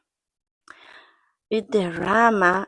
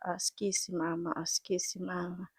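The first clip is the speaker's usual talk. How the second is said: much softer, hushed Askisi mama; mock soothing; 12 dB quieter than usual. Husband comforting his crying wife, softly soothing. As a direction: coo it like patting her shoulder. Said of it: "doesn't even know how to comfort someone".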